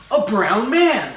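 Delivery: slow hammed-up gasp of discovery. Telling of Kevin at camp, slow mock astonishment. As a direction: drag it out like spotting a rare animal. Mock excited racist exclamation.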